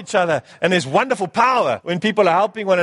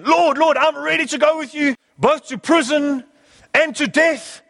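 Peter's bold vow; 8 semitones higher than usual. Retold or imitated. Peter vowing to Jesus he'll follow, high heroic pledge. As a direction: swear it high and proud, like a hero.

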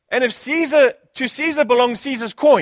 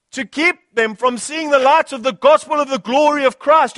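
fervent, ringing Bible reading; impassioned pulpit reading; declaimed scripture; 4 semitones higher, 4 dB louder than usual. Real, not acted. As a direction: proclaim it boldly to the congregation.

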